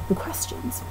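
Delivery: whispered aside, almost no voice. Real, not acted. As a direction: breathe the words to yourself. Repeats heading under her breath.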